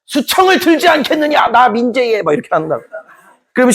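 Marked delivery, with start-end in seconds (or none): 0.0-2.2 high, hammed-up pompous official demanding favors; lecherous magistrate. Magistrate bossing a pretty woman, mock haughty. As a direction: ham up a greedy magistrate.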